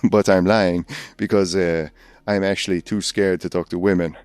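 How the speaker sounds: hammed-up bad French accent of a Paris concierge; a lightly done, cheesy French accent. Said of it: "the worst French accent I've ever done".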